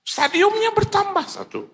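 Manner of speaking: slow, very high phone cry; high acted wail. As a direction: wail the news out slowly. Imagined outcome of the phone call. (ratapan tinggi dibuat-buat)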